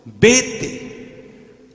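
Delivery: high, sharp command; commanding rebuke. Acted. Jesus drives off temptation, high sharp command. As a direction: cast the tempter out in one word. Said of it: "¿Cómo le dijo?".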